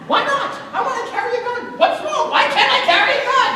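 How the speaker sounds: high, loud indignant outburst; indignant shout. Acted. Husband insists to wife on carrying gun, shouting. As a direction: demand it like a stubborn child.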